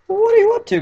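squeaky very high attempt that breaks off; failed Team Four Star voice. Shown as a demonstration. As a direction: overshoot a cartoony squeak and give up. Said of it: "I can't do the Team Four Star voice".

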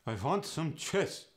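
"want" with a v, "cheese" said "chess"; a Yiddish speaker asking for cheese; Yiddish accent. Shown as a demonstration. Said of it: "cheese could be".

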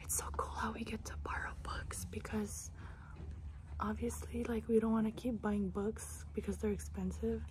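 soft whisper in the library. Real, not acted. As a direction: whisper so nobody is disturbed. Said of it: "Shh, library voice".

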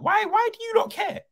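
very high, incredulous questioning; real bafflement. Real, not acted. Fan challenging those who worry about City, baffled high pitch. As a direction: push the question way up in disbelief. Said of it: "I'm confused".

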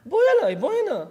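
high, insistent father's call; calling her over. Acted. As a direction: summon the child to you. Father calls daughter to him.

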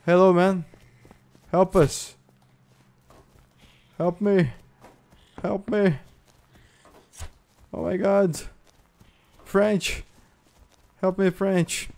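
high, plaintive calls; pleading for help. Pretend. Player begs passing players and French friend for help, pleading.

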